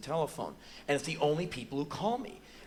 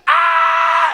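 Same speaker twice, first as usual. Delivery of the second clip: high, very loud scream; wild imitated child's shriek; kid's attack scream; 17 dB louder than usual. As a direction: scream like a kid going wild. Kids see him and attack.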